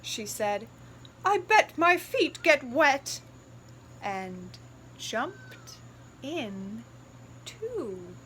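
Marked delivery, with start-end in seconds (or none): 1.2-3.2 louder, gleeful mother; decide to jump in; mother pig about to jump into the puddle; playful mother pig. Acted. 4.0-8.1 slow, softer, each word apart; slow soft ending as the mother jumps in; drawn-out ending. A narrator's reading. Slow read-aloud climax.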